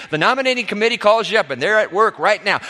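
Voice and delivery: normal talking voice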